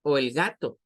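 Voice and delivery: usual speaking voice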